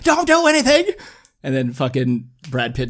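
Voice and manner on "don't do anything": high, loud desperate warning; hammed-up imitation of a pleading detective; Morgan Freeman. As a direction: cry out the mock plea. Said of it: "Morgan Freeman's like".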